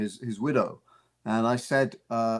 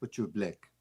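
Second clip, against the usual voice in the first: softly delivered South African movie line; low-key comic line in a South African accent; 3 semitones lower, 8 dB quieter than usual. Acted. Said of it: "doing it in the South African".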